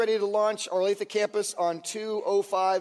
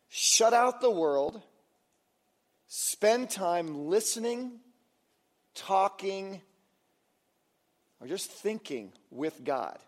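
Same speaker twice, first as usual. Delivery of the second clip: slow and gentle; slower than usual. Real, not acted. Slow, gentle invitation to prayer.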